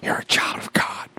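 hushed, voiceless whisper. Acted. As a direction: whisper it softly, like a private assurance. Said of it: "He whispers to you".

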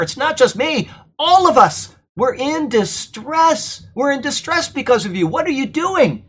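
high, pleading reproach in Paul's role; emphatic distress. Acted. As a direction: implore them, voicing shared dismay. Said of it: "That's the emphasis that Paul is giving".

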